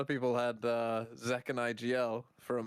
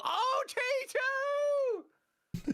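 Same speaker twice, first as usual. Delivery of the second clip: sudden high yell of triumph; shouting with glee at the round; excited shout. Real, not acted. Cheering a round win.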